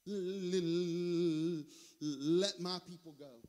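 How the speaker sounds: low, halting stutter on the L; stuttering Moses. Acted. Preacher acting out Moses before the president, stuttering. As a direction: stick on the first sound, then get the phrase out. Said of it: "with a speech impediment".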